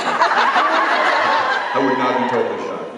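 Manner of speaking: drawn out slowly, laughing; slow emphatic. Acted. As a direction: stretch every word for effect.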